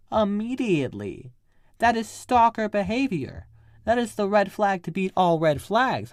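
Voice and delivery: loud, high, punchy alarm; emphatic warning. Real, not acted. Warning a girl off a man who watches her, loud and emphatic.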